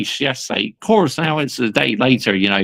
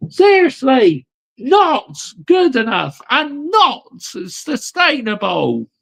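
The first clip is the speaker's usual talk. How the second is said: angry rant; 4 semitones higher than usual. Real, not acted. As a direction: hammer home the disgust. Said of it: "It needs to be a rant".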